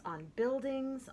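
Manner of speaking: ordinary delivery, nothing marked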